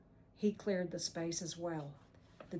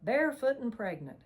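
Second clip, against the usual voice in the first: snickering; 5 semitones higher, 7 dB louder than usual. A narrator's reading. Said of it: "he snickered".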